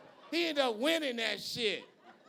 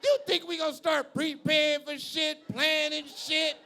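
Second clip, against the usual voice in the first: louder, higher mock disbelief; mock outraged retake; 4 semitones higher, 6 dB louder than usual. To the crowd, repeating the bit louder in mock disbelief. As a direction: throw the line back at the crowd, louder.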